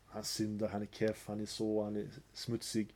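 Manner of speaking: mock the snobbish believer quietly; scornful judging. Mocking someone who looks down.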